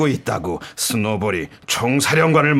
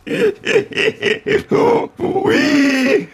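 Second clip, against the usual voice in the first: much higher, hammed-up cackle; high cackling laugh. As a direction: cackle off the danger. Pig laughs off the threat.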